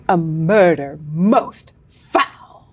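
slow, low spooky mystery voice; dramatic narrator. Pretend. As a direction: drag it out like a melodrama.

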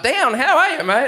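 high, loud, breezy mate greeting; a light hint of a cheery mate. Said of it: "he's like".

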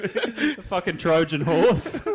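slow, high laughing line. Real, not acted. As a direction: say it while cracking up. Laughing remark on the trick.

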